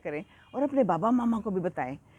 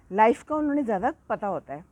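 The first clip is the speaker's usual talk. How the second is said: ordinary delivery, nothing marked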